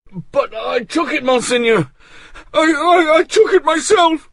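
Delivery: high, loud, panicked stammer; terrified. Acted. Bersamet terrified as Artois seizes him. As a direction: squeal it out in terror. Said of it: "in a terrified voice".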